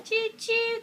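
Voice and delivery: high sing-song choo choo; train whistle. Shown as a demonstration. Mother showing her toddler the train sound, high sing-song. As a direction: toot it like a toy train. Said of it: "what does the train say?".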